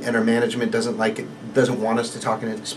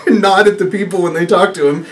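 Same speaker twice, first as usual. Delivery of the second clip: ordinary talk, nothing marked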